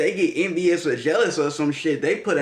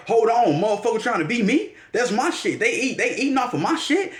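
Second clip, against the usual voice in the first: higher, possessive, affronted; jealous indignation; 7 semitones higher than usual. Retold or imitated. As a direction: claim what's yours, pitched up and offended. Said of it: "And now it's like".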